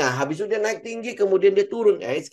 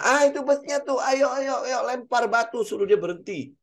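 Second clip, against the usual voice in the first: high, hurried mock excitement chasing the bus; imitating greedy latecomers all shouting ayo ayo; mock eager crowd; 8 semitones higher than usual. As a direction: ham up a frantic crowd pointing at the bus.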